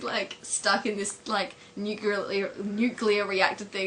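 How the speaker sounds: ordinary talk, nothing marked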